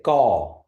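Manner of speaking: gaw said with the tone going up; rising gaw. Shown as a demonstration. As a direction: lift the pitch through gaw. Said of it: "you're going up and you're rising".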